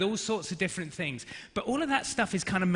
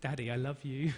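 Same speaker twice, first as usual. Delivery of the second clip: soft, lowered little plea; sweet-talking child; 4 semitones lower, 9 dB quieter than usual. Retold or imitated. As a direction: imitate a daughter coaxing quietly with affection. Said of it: "she's used different tactics".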